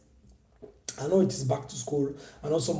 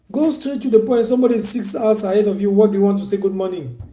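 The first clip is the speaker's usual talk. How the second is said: raised, higher exasperated rebuke; exasperated scolding; 5 semitones higher, 8 dB louder than usual. Real, not acted. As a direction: tell them off for wasting time. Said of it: "scolding the greeters".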